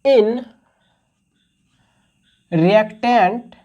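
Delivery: very slow, spaced-out classroom dictation. Real, not acted. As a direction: dictate word by word with long pauses. Teacher dictating a definition to students. (धीरे-धीरे, रुक-रुक कर लिखवाते हुए)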